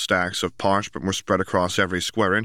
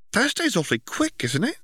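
put-on high bright girl voice, much higher; young girl voice. A narrator's reading. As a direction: voice Ginny as a teenage girl. Ginny's voice in story.

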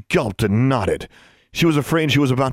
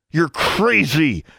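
slow, higher-pitched angry growl; growled through gritted teeth; 6 semitones higher, much slower than usual. A narrator's reading. Pinned girl answers her accuser, growling through gritted teeth. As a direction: force it out slowly through clenched teeth. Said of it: "Alexandra growled through gritted teeth".

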